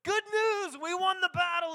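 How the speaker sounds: high-pitched shouted proclamation; loud, high herald's call to the whole city; watchman's announcement. Acted. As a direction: cry out the victory like a lookout. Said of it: "they would announce this stuff".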